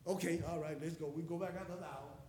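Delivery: low, soft, resigned mumble; weary giving in. Retold or imitated. Father agreeing to search another hour, quiet resignation. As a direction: give in quietly, mimicking reluctance. Gives in, resumes late-night search.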